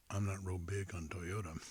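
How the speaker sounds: ordinary talk, nothing marked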